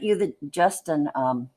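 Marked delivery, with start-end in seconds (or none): none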